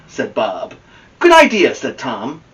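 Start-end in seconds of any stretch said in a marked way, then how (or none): 1.2-1.7 eager agreement. Acted.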